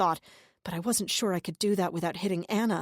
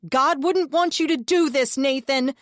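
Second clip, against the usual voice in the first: raised, urgent plea to reach him; pleading shout; 8 semitones higher, 8 dB louder than usual. A narrator's reading. Narrator pleading loudly with a deluded man. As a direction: call out loud, begging him to listen. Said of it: "I tried to get through to him".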